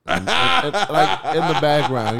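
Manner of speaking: laughing. Real, not acted. Laughing mid-sentence.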